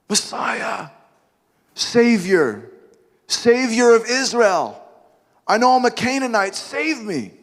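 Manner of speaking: a humble outsider begging a king; begging plea. Acted. Canaanite woman pleading to the great King, hushed begging. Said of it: "woman calling out to Jesus".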